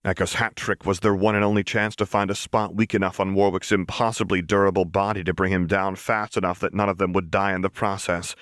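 plain reading voice

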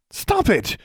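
high, sharp shout; a contained, high-pitched yell. A narrator's reading. Student to a goat nibbling her clothes, shouting. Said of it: "she shouted at one".

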